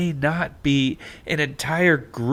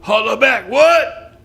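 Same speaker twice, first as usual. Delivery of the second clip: loud, high rapper shout; hyped rapper impression; 11 semitones higher, 7 dB louder, slower than usual. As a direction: fire it off as a rap ad-lib, jumping up on the last word. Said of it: "in the D M X voice".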